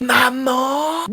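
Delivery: high, long-held call. Acted. Child late for school calling mum, drawn-out call. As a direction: stretch the word out to summon someone. Enfant appelle sa mère au réveil.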